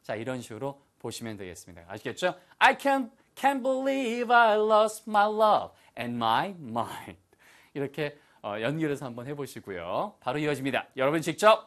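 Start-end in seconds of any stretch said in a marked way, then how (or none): 2.6-7.2 high, slow, theatrical lament; dramatic disbelief. Acted. Teacher models having lost love and mind, dramatically aghast. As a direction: draw it out high with melodrama.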